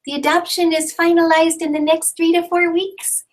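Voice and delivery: put-on high, sing-song little girl voice; speaking for the baby. Mother voicing her daughter's news to the class, high and childlike. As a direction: voice the toddler's happy announcement.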